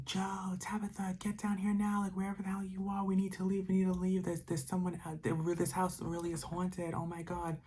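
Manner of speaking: high, held-back mock scream; urgent high-pitched pleading, imitating a frightened friend; imitated panicked screaming. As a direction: cry out in a high panic without full volume. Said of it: "So then he's screaming".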